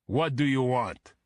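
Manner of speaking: put-on gruff demand in a thick Russian accent. A narrator's reading. Bodyguard Boris challenges FBI at the door, thick Russian accent. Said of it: "in the same thick accent".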